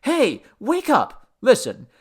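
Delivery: high, loud wake-up call; urgent rousing call. A narrator's reading. Man rousing a drunken god, urgent and loud.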